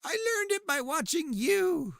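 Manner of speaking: high, slow mock-dramatic quote of the ad kid; mock-earnest son's line from the anti-drug ad; quoting the ad. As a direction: echo the ad kid's famous line, hammed-up.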